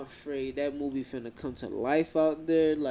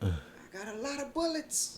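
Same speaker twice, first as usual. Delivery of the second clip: much higher mock holler, held back in volume; imitated hollering; 10 semitones higher, 5 dB quieter than usual. Man in shootout threatens the cops, mock hollering. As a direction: imitate a far-off holler in a high pitch. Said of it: "he was hollering at them like".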